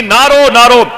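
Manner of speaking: high, loud call not to weep; urgent plea. Acted. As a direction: cry out "don't cry". Voice comforting Amina.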